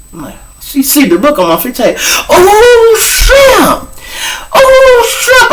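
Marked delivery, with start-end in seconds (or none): 2.3-5.5 high, loud woman's exclamation; high mock woman. Imitating his friend realizing the joke, high loud woman's cry. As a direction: shriek it in her voice.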